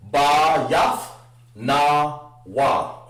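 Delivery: Ba Yath Na Wa, each syllable separate; wrong way with every syllable split. Shown as a demonstration. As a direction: chop Ba Yath Na Wa into pieces. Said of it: "to do it that way".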